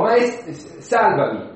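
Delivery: cried-out plea. Acted. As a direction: call for help, starting high and breaking off. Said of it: "e lui gridò". (grido d'aiuto recitato)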